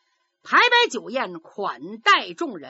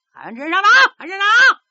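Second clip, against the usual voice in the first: calling out, the second call much higher and louder; relayed shout; 9 semitones higher, 9 dB louder, much faster than usual. Acted. Runners relaying the summons through the court, rising shout. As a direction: pass the summons down the hall, building.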